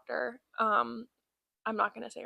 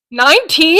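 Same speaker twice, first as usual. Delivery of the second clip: loud questioning call; 6 semitones higher, 13 dB louder than usual. Retold or imitated. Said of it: "She says it like that".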